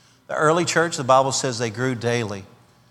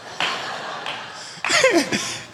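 high breathy laugh; 5 dB quieter than usual. Real, not acted. Reaction to the cop.

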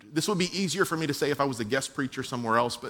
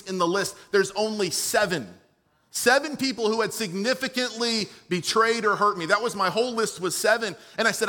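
higher-pitched, driving sermon voice; impassioned preaching; 7 semitones higher, 4 dB louder than usual. Real, not acted. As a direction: press the point home to a congregation. Preaches at full force.

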